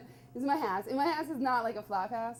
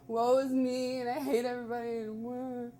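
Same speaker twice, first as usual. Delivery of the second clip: mock whining; 2 semitones higher than usual. Mocking his own self-pity.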